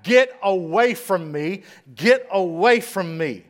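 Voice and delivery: loud, slow shout pushing people away; retold scream. Fallen governor shouting at the crowd, loud slow yell. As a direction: bark it out, drawn out and angry. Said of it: "He starts screaming back".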